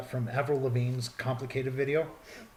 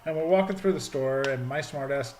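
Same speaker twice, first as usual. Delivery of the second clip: ordinary talk, nothing marked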